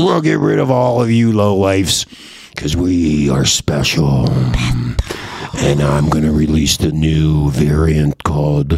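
low, slow alien villain. Acted. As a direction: menace them like an invading alien.

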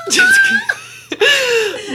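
Jessica stretched very slowly, high, through a laugh; laughing, drawn-out name. Pretend. Savouring her co-host's name as a porn name, a long, high laughing "Jessica".

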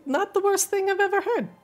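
high squeaky critter; twitchy squeaking rat voice. Acted. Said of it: "I love your fucking rat's voice".

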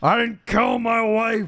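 slow, high, loud parody protest; desperate mock shout. Fugitive protesting his innocence, over-the-top mock shout. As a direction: cry it out in hammed-up desperation.

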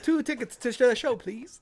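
put-on high, eager child voice; excited kid voice. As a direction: beg like a little kid. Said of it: "six-year-old me".

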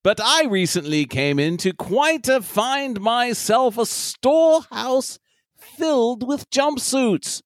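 slow, silly mock British gent; hammy British cousin; British accent. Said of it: "some British douchebag".

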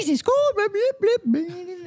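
high-pitched Scottish gibberish; mock Scottish accent. Shown as a demonstration. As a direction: babble in a cartoonish, squeaky Scottish accent. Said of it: "no one ever taught you".

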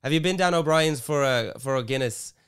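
put-on Irish lilt; hesitant attempt at an Irish accent. Shown as a demonstration. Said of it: "how do you do the Irish".

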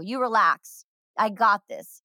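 usual speaking voice

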